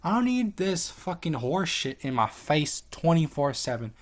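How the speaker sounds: high, loud, slow mock outburst; angry retort. Telling the Peel recruiters to back off, loud high outburst. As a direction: blow up at them, dragging the words. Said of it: "And I'm like".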